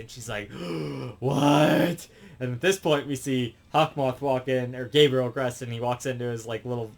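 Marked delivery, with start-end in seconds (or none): 0.5-2.1 gasp, then a loud "what?"; acted-out gasp of disbelief; shocked. She hears he's Felix, acted shock. Acts her shock at the reveal.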